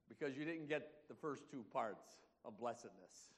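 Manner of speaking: ordinary talk, nothing marked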